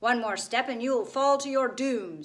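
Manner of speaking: loud, menacing gargoyle warning; wicked gargoyle. A narrator's reading. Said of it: "wicked chuckle of a gargoyle".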